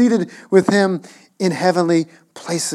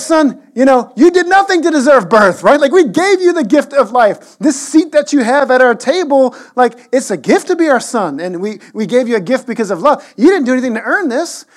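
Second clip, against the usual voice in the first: lecturing parent; 5 semitones higher, 5 dB louder than usual. Pretend. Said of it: "we would be like".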